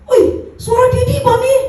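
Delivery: high, excited retold cry; a mock amazed call of recognition; excited exclamation. Retold exclamation on hearing pastor. (seruan gembira yang ditirukan)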